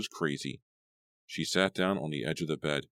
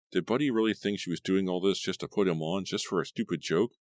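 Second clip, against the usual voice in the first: ordinary delivery, nothing marked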